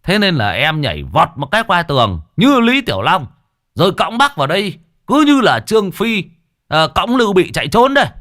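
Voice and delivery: high, loud, fast bragging; boastful excitement. Acted. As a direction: brag with rising excitement. Neighbour boasting of heroics.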